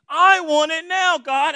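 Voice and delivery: high, loud, drawn-out mock demand; impatient. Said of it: "a lot of times we're impatient".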